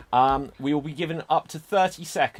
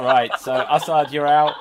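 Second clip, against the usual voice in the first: laughing; 8 dB louder than usual. Real, not acted. Host repeats elimination, laughing.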